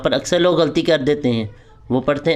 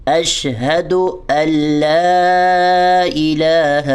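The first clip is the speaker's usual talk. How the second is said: اشہد ان لا الہ chanted very slowly; slow recitation; 2 semitones higher, much slower than usual. Shown as a demonstration. Teacher reciting carefully for students. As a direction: hold each syllable long. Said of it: "آپ نے اس پہ بڑی احتیاط کرنی ہے".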